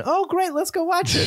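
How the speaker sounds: high mock-cheerful wife voice; sarcastic wife. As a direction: squeak it out like an unimpressed wife mocking her husband. Acting the wife's sarcastic reply.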